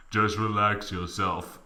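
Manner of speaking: ordinary talk, nothing marked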